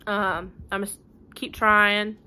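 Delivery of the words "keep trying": ordinary delivery, nothing marked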